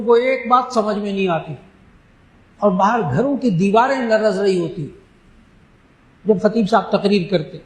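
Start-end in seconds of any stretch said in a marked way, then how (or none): none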